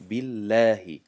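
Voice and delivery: بالله said loud, with a thin light lam; thin lam in Allah. Shown as a demonstration. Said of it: "له را دیدید؟". (بالله با لام نازک و بلند)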